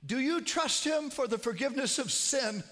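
loud, high pleading question. Retold or imitated. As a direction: urge her loudly to answer. Calling to deaf mother-in-law.

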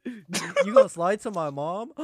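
high, loud fake shock; mock outrage. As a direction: play up the betrayal.

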